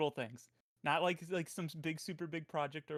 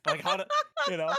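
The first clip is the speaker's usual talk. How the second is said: normal talking voice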